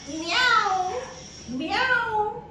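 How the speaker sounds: high-pitched imitated meow; cat meow. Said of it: "What does the cat do?".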